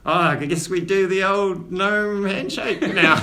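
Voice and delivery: a hint of a cheerful old gnome. Gnome voiced by GM.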